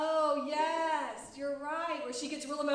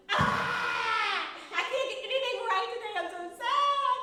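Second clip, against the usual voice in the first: high, loud, hammed-up wail; mock meltdown; 6 semitones higher, 7 dB louder than usual. Teacher acting out a bad day for children, mock meltdown. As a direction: whine it loudly like a playful tantrum. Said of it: "days like that where you're like".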